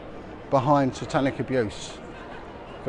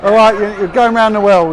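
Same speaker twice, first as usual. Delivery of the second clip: loud, higher hearty street call; calling out loudly; 7 semitones higher, 11 dB louder than usual. Real, not acted. Calling to a stranger passing by, loud and hearty. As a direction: shout it warmly over to them.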